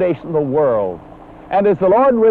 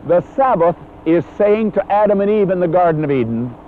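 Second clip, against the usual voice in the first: ordinary talk, nothing marked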